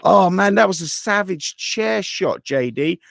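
very high, wide-eyed fanboy excitement; excited wrestling fan. Acted. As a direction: gush like a thrilled fan, hammed-up. Acting himself as thrilled fan.

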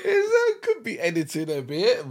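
high, slow, loud laughing. Real, not acted. Husband laughing about the botched wood planking. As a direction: wheeze it out mid-laugh.

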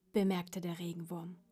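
ordinary delivery, nothing marked

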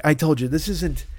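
ordinary talk

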